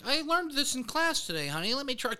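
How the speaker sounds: high, sugary mock tone; playful high put-on voice; put-on sweet voice. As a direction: sweeten it up like talking to a partner.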